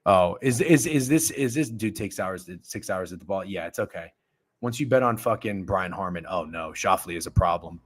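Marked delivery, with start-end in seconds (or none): none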